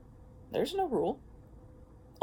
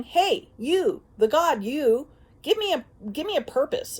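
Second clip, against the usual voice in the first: energy pleading; 6 semitones higher, 6 dB louder than usual. Retold or imitated. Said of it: "energy that's going".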